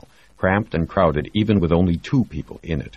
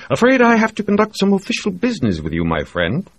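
higher, quicker voice for the smug doctor; sly ship doctor; 5 dB louder than usual. Acted. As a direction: play the smug, teasing physician. Doctor Reed's voice.

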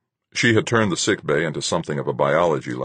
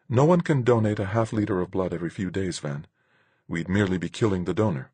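higher-pitched woman's voice; objecting woman character; 4 semitones higher than usual. Acted. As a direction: reason with him in a higher voice. Voices Marguerite in story.

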